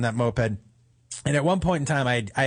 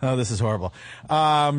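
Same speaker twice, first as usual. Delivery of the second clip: ordinary talk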